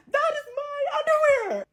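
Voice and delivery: claiming the underwear, wailing; wailing outrage. Real, not acted. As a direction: cry out in mock despair. Outraged climax of rant.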